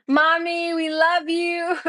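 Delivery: put-on high, slow little-child voice; sing-song it like the children talking; kids' voice. Saying goodbye to Mommy for the kids, a high childlike voice.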